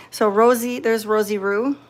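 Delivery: usual speaking voice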